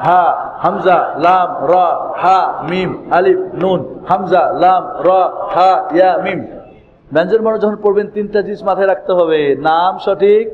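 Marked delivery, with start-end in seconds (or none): 0.1-6.4 brisk, even run of letter names; quick letter drill. Shown as a demonstration. Teacher leading learners through the letters together, quickly. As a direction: rattle through the letters in rhythm. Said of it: "দ্রুত বলেন আমার সঙ্গে".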